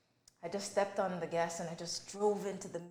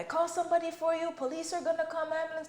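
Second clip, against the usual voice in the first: much higher, worried helper voice; higher pitched stranger asking if she needs help; concerned stranger; 9 semitones higher than usual. Retold or imitated. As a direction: voice the driver offering help, pitched up. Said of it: "and he said".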